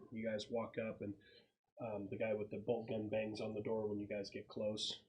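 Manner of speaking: ordinary talk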